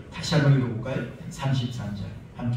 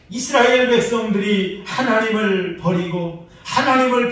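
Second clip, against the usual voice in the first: rising, louder, higher preaching up to a shout; full-force preaching; 9 semitones higher, 10 dB louder than usual. Acted. Pastor pressing the prophet's tears on the church in full-force preaching. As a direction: preach it to the rafters.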